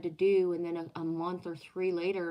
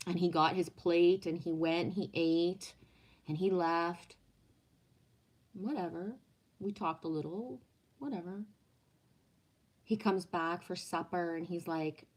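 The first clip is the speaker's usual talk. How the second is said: ordinary talk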